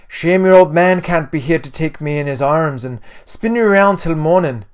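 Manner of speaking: wistful words called out in hearty Irish; put-on Irish mum, projecting loudly; weird Irish accent. A narrator's reading. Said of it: "a weird Irish accent".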